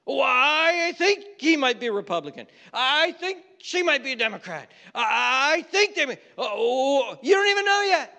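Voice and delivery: loud, high-pitched overblown alarm; mock alarm. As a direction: sound the alarm about the other tribe, hammed up. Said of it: "up in alarms".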